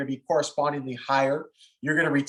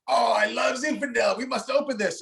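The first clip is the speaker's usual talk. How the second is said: high-pitched, excited French impression; delighted French winemaker; 7 semitones higher than usual. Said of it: "in his heavy French accent".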